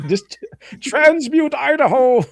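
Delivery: high, slow squeaky echo of the joke; mock high echo. Echoing the co-host's joke back in a high, slow squeak.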